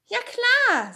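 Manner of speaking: put-on high, loud, delighted boy's shout; eager yes. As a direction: burst out with thrilled agreement. Tommy eagerly agrees.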